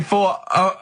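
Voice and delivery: normal talking voice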